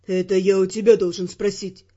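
low male voice, stern; stern Ferrant. Acted. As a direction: turn it back on her.

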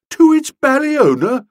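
high, loud, aghast question; gasping in disbelief. A narrator's reading. Friend stunned by the order, gasping. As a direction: echo it as if appalled. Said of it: "gasped Boko".

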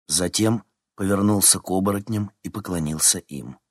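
ordinary delivery, nothing marked

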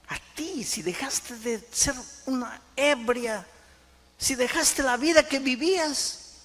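high, slow, building in volume; impassioned acted declaration. Husband marvelling that Jesus changed his wife, impassioned acted words. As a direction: stretch each condition, rising to a loud peak.

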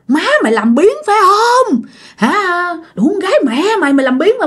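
high-pitched angry nagging, imitated; retold tirade of a cursing stepmother; stepmother's scolding. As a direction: scold a lazy stepchild in a high, pushed voice. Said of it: "bà nhất quyết bà chửi".

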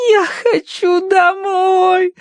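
high, slow sobbing whine; crying child. A narrator's reading. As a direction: sob it out, sniffling. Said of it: "захлюпала носом Агнес".

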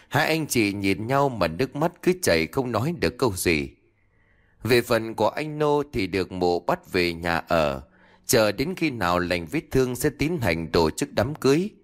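plain reading voice

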